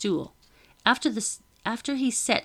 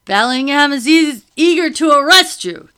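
usual speaking voice

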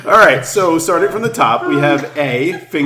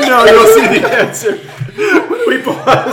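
laughing; 11 semitones higher, 6 dB louder than usual. Real, not acted. Hosts collapsing in laughter.

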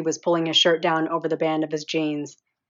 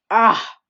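loud climax cry; 5 semitones higher, 9 dB louder than usual. Acted.

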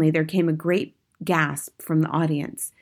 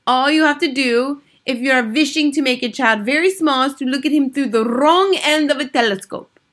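put-on high, loud accented witch lecture; imperious Grand High Witch; 8 semitones higher, 6 dB louder than usual. A narrator's reading. Grand High Witch teaching witches her recipe, imperious.

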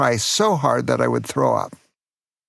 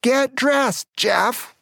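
much higher, sharp mother's shout; angry yell; 10 semitones higher, much slower than usual. Retold or imitated. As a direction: yell it at the stubborn kid. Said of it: "she got really angry and yelled at me".

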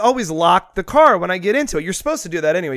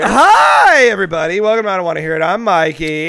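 show host intro; 2 semitones higher, 7 dB louder than usual. Acted. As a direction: greet listeners like a host. Show opening.

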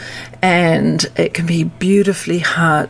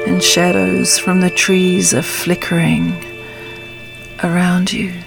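calm grounding exercise. Acted. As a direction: guide them gently into stillness. Leads a meditation.

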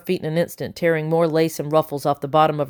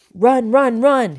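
loud, high urgent cry of run; 7 semitones higher, 5 dB louder, slower than usual. Acted. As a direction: yell it as a desperate warning. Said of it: "he cried".

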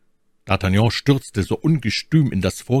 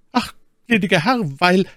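high, fast, flustered excuse; timid servant rushing to explain; 12 semitones higher, 3 dB louder than usual. A narrator's reading. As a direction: plead hastily with the master in a high voice. Scared servant excuses himself to master.

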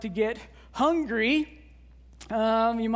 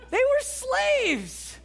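exasperated insistence; 11 semitones higher, much slower than usual. Shown as a demonstration. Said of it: "repeated for emphasis".

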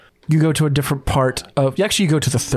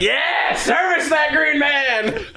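high, slow, mocking shout; heckling orc; 11 semitones higher, slower than usual. Orc down the hall heckles the butler, high slow shout. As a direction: call out a crude tease. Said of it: "you're like".

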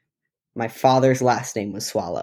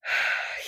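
weary sigh; 8 dB quieter than usual. Acted. Ranger reacts to Kyle's handshake.

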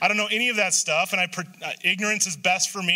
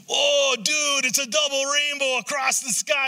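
double rainbow guy; 7 semitones higher, slower than usual. Retold or imitated. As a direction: gush like a stoner seeing a miracle. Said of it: "Remember the double rainbow guy?".

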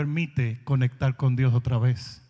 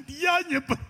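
high, mock-astonished question; squeaky disbelief at eighteen years; incredulous reply; 4 dB louder than usual. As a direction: act floored by the absurd story. Retold incredulous reply to woman.

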